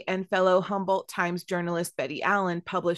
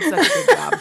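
high, loud mock praise; sarcastic; 8 dB louder than usual. Real, not acted. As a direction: gush with fake admiration. Joining the mocking of Bigfoot's name.

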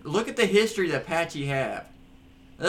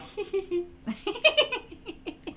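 witch cackle. Shown as a demonstration. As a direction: cackle like a cartoon witch on request. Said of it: "God, that's like a witch".

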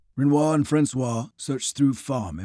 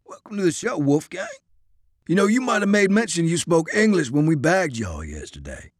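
scornful Southern soldier; 6 semitones higher than usual. Acted. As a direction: scoff at the plan, bitter. Isaiah voiced, sardonic.

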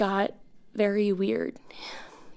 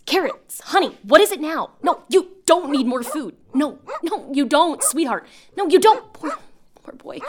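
higher sing-song pet voice, coaxing then firm; talking to the dog; 5 semitones higher, 4 dB louder than usual. Acted. Mid-voicemail, turning to tell her dog Carrots no, higher pet-talk. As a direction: coax the hungry dog like a fussing owner.

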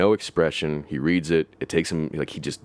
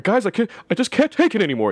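higher, whiny mock panic; flustered whine; 3 dB louder than usual. Flustered coworker quits on the crew, mock higher whine. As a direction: play him overwhelmed and helpless.

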